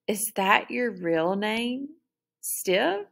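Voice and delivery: slow, sneering and high; mocking sneer. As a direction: taunt her with a mocking sneer. Molly mocks Beatrice.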